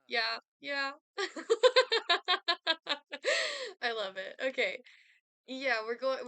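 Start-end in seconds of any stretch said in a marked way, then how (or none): 1.0-3.8 big burst of laughter; loud laugh. Real, not acted. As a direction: laugh out loud, high and hearty. Reacting to a funny outfit.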